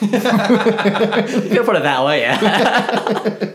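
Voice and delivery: slow, high, laughing through it. Real, not acted. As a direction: giggle it out slowly. Laughs at crude remark.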